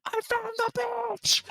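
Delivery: very high, strained far-off holler; thin, airy mock shout from another room; Rob. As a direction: imitate a yell coming from upstairs. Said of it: "voice in the distance when he's upstairs".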